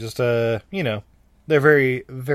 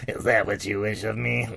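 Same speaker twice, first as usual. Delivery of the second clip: a hint of a creepy genie; low, eerie wish-granting voice; 3 semitones lower than usual. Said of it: "that voice is coming up a lot".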